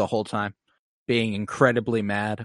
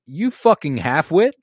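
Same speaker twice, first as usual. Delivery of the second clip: scornful insult; 6 semitones higher, 6 dB louder than usual. Retold or imitated. Acted insult from Tyrion in quarrel.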